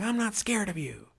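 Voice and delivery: high-pitched mimicry of a kid; little kid. Little kid sizing up a man in line, high mocking imitation. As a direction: imitate a defiant child in a high voice. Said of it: "there was this little kid".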